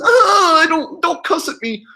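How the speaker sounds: very high mock shriek, then squeal; mock offended woman. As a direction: imitate her fleeing in exaggerated alarm. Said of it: "And then she goes".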